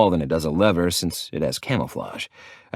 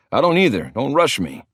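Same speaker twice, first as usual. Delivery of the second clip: tense snapped reply, pitched higher; hissing; 6 semitones higher, 3 dB louder than usual. A narrator's reading. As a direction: snap back under pressure while climbing. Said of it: "he hisses at me".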